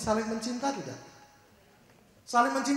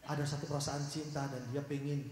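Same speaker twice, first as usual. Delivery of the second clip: ordinary talk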